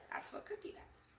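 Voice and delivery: breathy unvoiced whisper; whispered aside. Milk telling the kids about Cookie, whispered. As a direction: share it like a secret.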